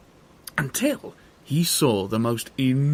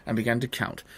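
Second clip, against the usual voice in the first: ordinary delivery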